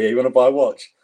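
hamming up a dodgy street seller's sales pitch; Afghan Del Boy. Retold or imitated. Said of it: "Afghan Del Boy".

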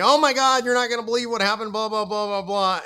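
high, loud breathless gossip rush; excited friend. Retold or imitated. Friend rushing in with gossip, high excited gush. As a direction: gush like a friend with shocking news. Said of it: "Your friends are gonna come to you".

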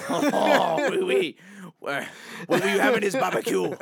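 giggling mock French accent; hammed-up French accent. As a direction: play a silly Frenchman, laughing.

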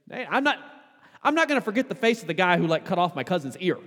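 high, loud, quick outraged accuser; emphatic accusation. Retold or imitated. Servant's cousin accusing Peter by the fire, loud and emphatic. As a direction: jab the words at him, certain of the face. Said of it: "It's, it's emphatic".

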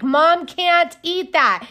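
mock exclaim, drawn out slow; a daughter pointing out the obvious, high and drawn out; high, loud teen scold. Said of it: "she's like".

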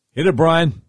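ordinary talk, nothing marked

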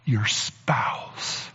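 unvoiced whispered punchline. Real, not acted. Pastor telling the congregation the key point, whispered. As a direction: drop to a whisper to land it.

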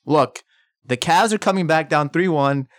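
usual speaking voice

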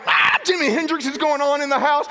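high, loud excited call; excited exclaim. Retold or imitated. Late-night guitar player hyping himself. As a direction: announce the guitar gleefully.

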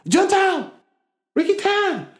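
very high, loud imitated shriek; imitating a streamer's screamed taunts; mock screaming. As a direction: scream it out in a high-pitched put-on voice. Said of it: "then he starts screaming".

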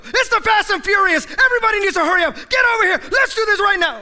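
high, loud, fast hurry-up shouts; frantic rushing. Acted. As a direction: herd everyone out in a panic. Frantic household rush.

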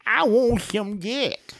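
put-on high, slow old woman voice; drawn-out, high granny demand; old lady voice. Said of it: "She goes".

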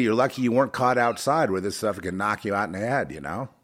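ordinary delivery

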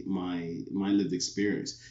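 ordinary delivery

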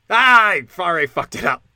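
loud cry of self-defeat; exasperated outburst. Real, not acted. Host failing his own challenge, loud exasperation. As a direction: burst out loudly at your own slip.

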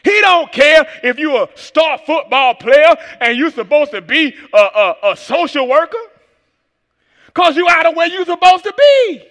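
loud, driving preacher shout; preaching at full force. Acted.

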